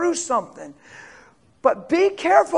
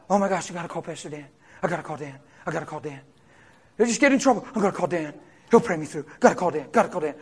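mock panicked caller; 10 semitones lower, 8 dB quieter, much faster than usual.

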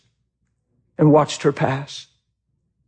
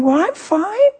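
high-pitched sweet reply; uncomplaining mother; 14 semitones higher, slower than usual. Retold or imitated. Mother answering her child from bed, high cheerful imitation. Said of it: "How you doing, Mom?".

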